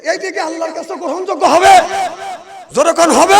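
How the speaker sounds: high, loud demand for a response; rally the crowd to answer loudly; rousing call. Real, not acted. Preacher urging the audience to affirm, rousing shout.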